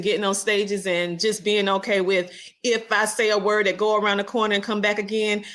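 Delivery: usual speaking voice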